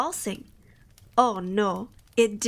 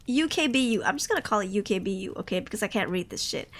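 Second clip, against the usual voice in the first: ordinary talk, nothing marked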